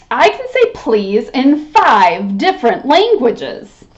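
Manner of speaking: ordinary delivery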